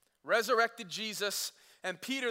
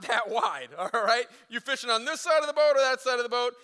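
usual speaking voice

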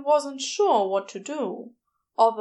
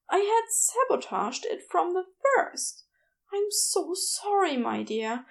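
higher, gentle fussy Aziraphale voice, embarrassed; flustered angel; 8 semitones higher than usual. A narrator's reading. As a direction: play a flustered, apologetic angel.

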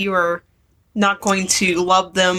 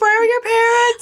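mock panicked squeal.

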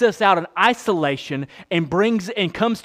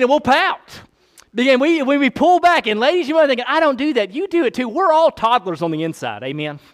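higher, louder preaching; preaching fervor; 8 semitones higher, 5 dB louder than usual. Real, not acted. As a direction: push it out to the whole room. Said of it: "preaching at full force".

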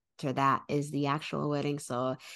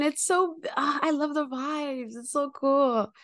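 higher, louder, delighted rave; excited gushing; 11 semitones higher, 4 dB louder than usual. Real, not acted. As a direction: gush about it with delight. Gushing about Brooklyn.

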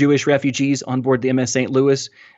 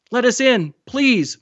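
high, desperate plea; begging; 11 semitones higher, slower than usual. A narrator's reading. Boy clinging to the dinghy to those aboard, begging. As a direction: plead urgently, voice pitched way up. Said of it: "Mahmoud begged".

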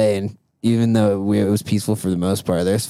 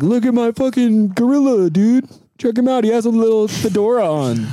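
high, loud showoff voice; bragging NFT bro; 11 semitones higher, 4 dB louder than usual. Pretend. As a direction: brag like a crypto bro. Mocking NFT buyer bragging.

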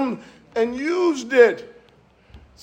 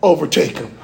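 deep, calm divine command; voice of God; 7 semitones lower than usual. Acted. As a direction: speak as God sending David out. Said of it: "guess what God says to him".

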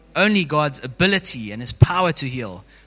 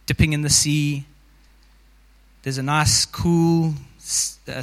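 guided meditation. Acted. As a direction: ease the congregation into the scene.

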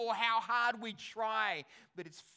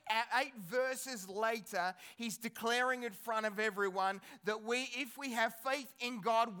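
normal talking voice

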